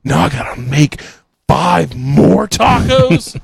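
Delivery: mock-grumbling cook stuck remaking tacos. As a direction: complain like an annoyed fry cook. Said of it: "and was like".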